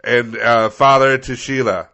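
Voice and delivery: loud, portentous proclamation, easing off at the end; Gandalf-style resident. Acted. Resident vowing revenge on plow driver, grand declamation. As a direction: declaim it like an epic hero. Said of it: "giving their best Gandalf".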